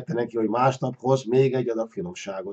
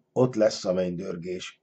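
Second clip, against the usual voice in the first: slightly lower, softer aside; talking to himself; 5 semitones lower, 6 dB quieter than usual. A narrator's reading. Man works out the way to the dragon, talking to himself softly. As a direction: say it softly, as a note to self. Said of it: "mondta magának".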